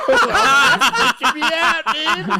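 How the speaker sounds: high laughing outburst; laughing and spooked. Real, not acted.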